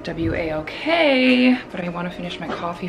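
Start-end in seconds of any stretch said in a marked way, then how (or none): 0.0-1.6 W A L K said slowly letter by letter; spelled out. Real, not acted. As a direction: spell W A L K out slowly so the dog won't catch on. Spelling walk in front of dog.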